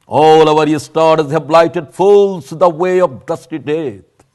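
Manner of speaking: very slow declaimed Shakespeare; dramatic poetry recitation. Shown as a demonstration. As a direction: declaim it like a stage actor. (ধীর নাটকীয় আবৃত্তি)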